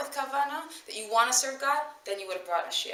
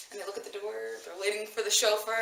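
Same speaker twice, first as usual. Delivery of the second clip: ordinary talk, nothing marked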